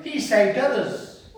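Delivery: sneering priest's mockery; mocking. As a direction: taunt with drawn-out scorn. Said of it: "mocked him amongst themselves".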